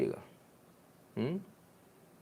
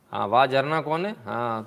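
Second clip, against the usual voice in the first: usual speaking voice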